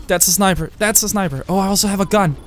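usual speaking voice

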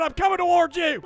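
ordinary delivery

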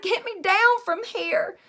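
loud, high, slow plea for help; stuck up high, shouting for help; shouted plea. A narrator's reading. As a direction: yell it down from up high. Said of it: "I shouted".

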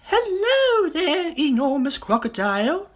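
very high, loud bird greeting; singsong bird voice. Acted. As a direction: sing it out like a chirpy little bird. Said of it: "sang the roly-poly bird".

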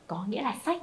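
ordinary talk, nothing marked

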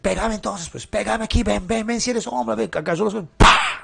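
high, taunting dare of an angry wife; retelling a wife daring her husband to hit her; mock provoking challenge. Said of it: "ella también le está diciendo".

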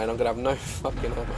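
low, quiet words breaking into laughter; soft, rueful laughing; helpless laughing. Real, not acted. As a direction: let a giggle take over mid-sentence. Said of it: "I don't know why I'm laughing".

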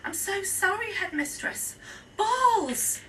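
apologise, then blurt the last word; flustered apology. A narrator's reading. Teacher apologising to the headmistress, blurting helplessly.

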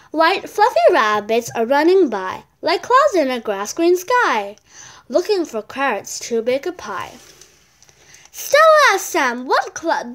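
loud, lively read-aloud voice for a picture book; bright storybook reading; reading a story aloud. Acted. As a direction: read it out to the class with energy. Child reads poem and story.

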